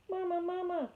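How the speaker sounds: put-on high, tiny baby-bird cry for its mother; peeping gosling. A narrator's reading. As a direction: peep it out high like a newborn gosling. Gosling voice held.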